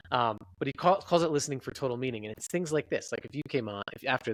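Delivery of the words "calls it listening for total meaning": ordinary talk, nothing marked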